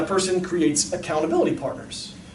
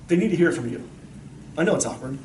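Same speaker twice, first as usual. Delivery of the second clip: normal talking voice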